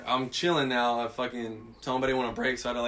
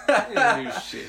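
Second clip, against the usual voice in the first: loud, high, through real laughter; laughing; 9 semitones higher, 4 dB louder, slower than usual. Real, not acted. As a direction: crack up while repeating the punchline. Laughing at the anticlimax.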